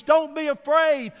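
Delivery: rising preacher's cry of comfort; emphatic preaching. Acted. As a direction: hit "Don't be afraid" high and loud, slowly. Preacher's climactic command.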